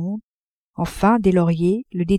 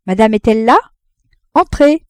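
louder call from outside; 3 semitones higher, 7 dB louder than usual. A narrator's reading. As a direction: call through the door. Clerk calls from outside. (le commis appelle du dehors, plus fort)

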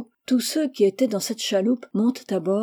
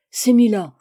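vive exclamation plus forte; le capitaine la reconnaît et s'écrie; 7 dB louder than usual. A narrator's reading. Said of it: "s'écria le capitaine". (le capitaine reconnaît Mila, exclamation forte et soudaine)

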